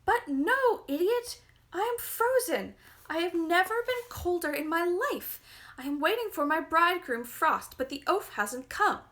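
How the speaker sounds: high, loud furious shriek. A narrator's reading. Spoilt girl shrieking insults at Frost in the storm. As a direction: scream it back at him in rage. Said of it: "The girl shrieked back".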